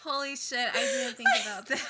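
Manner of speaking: high, loud, fast laughing exclamation; laughing shock. Real, not acted. Cohost shocked by radioactive idea, laughing loud and high. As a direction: burst out laughing in disbelief.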